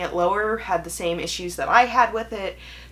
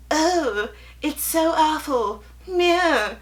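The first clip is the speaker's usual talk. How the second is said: high, louder mock whine; mocking gloomy book; 10 semitones higher, 3 dB louder, slower than usual. Booktuber mocking gloomy self-help books, high whiny voice. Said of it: "have been like".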